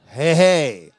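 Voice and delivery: loud rousing hey hey; hyped call. Real, not acted. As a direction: pump up the congregation. Preacher rousing the crowd.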